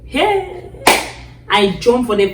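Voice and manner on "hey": high, drawn-out exclamation; startled cry. Retold or imitated. Woman retelling finding she overslept, startled cry. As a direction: cry out high in retold shock.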